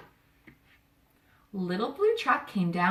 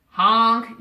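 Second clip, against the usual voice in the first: loud honking yell; big truck horn honk; 10 dB louder than usual. A narrator's reading. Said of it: "yelled a big dump truck".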